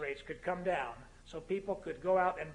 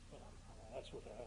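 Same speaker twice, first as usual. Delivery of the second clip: ordinary talk, nothing marked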